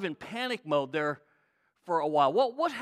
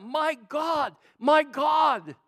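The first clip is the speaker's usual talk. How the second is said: high, loud anguished call; raised, drawn-out cry; crying out in pain; 6 semitones higher, 6 dB louder, much slower than usual. Acted. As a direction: call up to God in agony. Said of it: "cried out".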